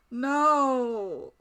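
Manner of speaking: normal talking voice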